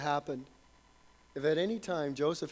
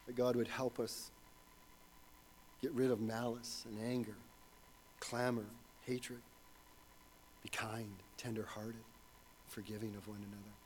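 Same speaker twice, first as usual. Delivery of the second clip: softer with every phrase, down to near hush; fading prayer; 5 semitones lower, 12 dB quieter than usual. Real, not acted. Pastor praying over his church, growing ever softer. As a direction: let the prayer sink into a hush.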